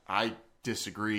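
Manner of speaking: usual speaking voice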